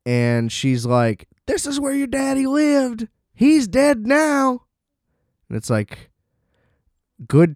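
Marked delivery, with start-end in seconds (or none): 1.5-4.6 much higher put-on voice, cold and mean; hammed-up wicked mother delivering bad news; high mocking villain-mom voice, slowing at the end; mock cruel mom. Mother taunts child about father.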